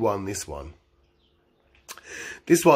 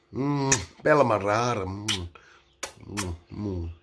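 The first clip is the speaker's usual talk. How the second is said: savouring murmur; 4 semitones lower, 6 dB quieter than usual. Acted. Savouring the name.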